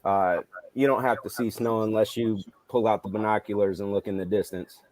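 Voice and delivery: ordinary talk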